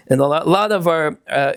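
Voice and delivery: ordinary talk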